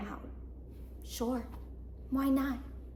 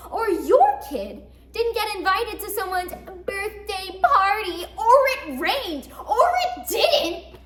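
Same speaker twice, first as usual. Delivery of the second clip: exasperated list; 2 semitones higher, 5 dB louder than usual. Acted. Woman listing everything she must apologize for, increasingly exasperated.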